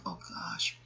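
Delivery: breathy whisper of alarm; soft unvoiced oh gosh; whispered dismay. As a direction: gasp it under your breath. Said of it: "I'm like".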